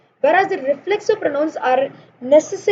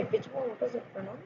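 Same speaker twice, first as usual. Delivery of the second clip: aside to self; 2 semitones lower, 17 dB quieter, faster than usual. Real, not acted. Teacher checking which pronoun comes next, murmured to self. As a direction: drop right down, as if checking privately.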